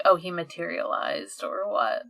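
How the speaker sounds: ordinary delivery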